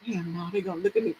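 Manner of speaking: quick, low, hushed worry; soft and fast inner objection; talking to herself. Retold or imitated. Shy woman arguing back to herself, hurried hushed self-talk. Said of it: "I had to talk to myself".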